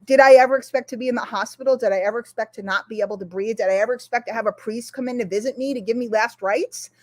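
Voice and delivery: ordinary talk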